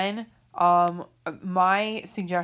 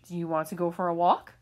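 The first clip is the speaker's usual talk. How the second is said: demonstrating a question rise on walk; rising question tune; faster than usual. Shown as a demonstration. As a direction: pitch lifts sharply on walk. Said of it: "a question ends in a raised pitch".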